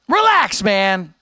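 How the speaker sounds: exasperated high-pitched snap; exasperated outburst. Real, not acted. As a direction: burst out high, fed up with the chat. Exasperated second take at chatter.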